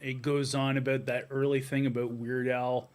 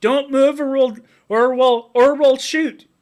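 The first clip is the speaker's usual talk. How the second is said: high, loud barbarian threat; 10 semitones higher, 10 dB louder than usual. Retold or imitated. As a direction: yell it like a hammy brute. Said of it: "all the Canadian cops".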